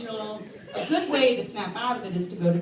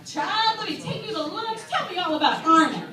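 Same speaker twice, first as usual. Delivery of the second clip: high, delighted invitation; imagined Oprah; 7 semitones higher, 3 dB louder than usual. Pretend. Oprah inviting the comedian to lunch, high and delighted. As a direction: play a charmed celebrity. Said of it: "she's gonna be like".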